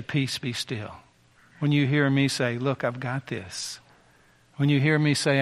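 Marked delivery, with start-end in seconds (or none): 0.0-1.0 hushed, gentle calm; soft voice of God. Acted. Preacher voicing God to weary listener, hushed and tender. 2.6-3.8 slow, hushed, calm; slow and soft, settling a fear; soft reassurance. Acted. God reassuring a troubled believer, slow and hushed. Preacher voices God speaking softly.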